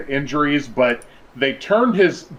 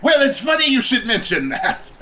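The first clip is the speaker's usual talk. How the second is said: villain voice; 11 semitones higher than usual. Retold or imitated. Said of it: "Kuro pops up like".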